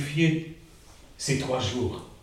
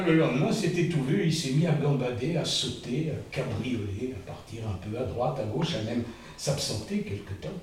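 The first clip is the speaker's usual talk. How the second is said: usual speaking voice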